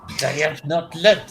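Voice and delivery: sheepish officer, fake French accent. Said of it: "that was my fake French accent".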